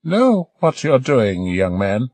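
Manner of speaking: put-on high, slow, quizzical old voice; quizzical old lady. Old lady quizzing the young doctor, slow and high. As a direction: probe him doubtfully. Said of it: "looked quizzically".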